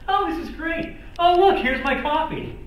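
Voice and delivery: high, loud, rubber-faced manic voice; Jim Carrey impression. Impressionist to the audience, as Jim Carrey, high and loud. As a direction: bounce in hammed-up as the zany comic. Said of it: "I do him".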